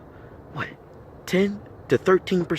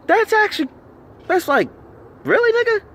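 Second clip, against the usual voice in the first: incredulous. Real, not acted.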